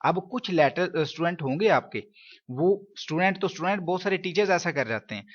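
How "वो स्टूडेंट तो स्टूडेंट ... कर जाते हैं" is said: ordinary delivery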